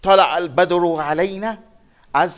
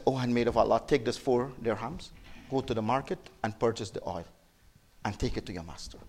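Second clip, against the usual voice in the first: low, very soft kindly instruction; gentle reassurance; 7 semitones lower, 13 dB quieter than usual. Retold or imitated. Prophet sending the frightened maid to market, gentle reassurance. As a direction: lower the voice and soothe, fading softer.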